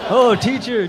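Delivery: hammed-up kid calling teacher; mock whiny kid. Bullied kid calling to the teacher, mock whine. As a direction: wail for the teacher, mock upset. Whining to teacher about bully.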